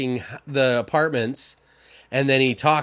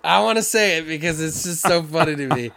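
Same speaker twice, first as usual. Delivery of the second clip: ordinary talk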